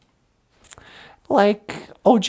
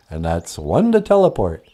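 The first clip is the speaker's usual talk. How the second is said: much lower, odd voiced character; low puppet voice; 6 dB quieter than usual. Puppet answering Natasha, deep voiced character.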